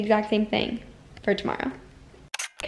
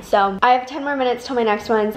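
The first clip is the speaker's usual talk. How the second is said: normal talking voice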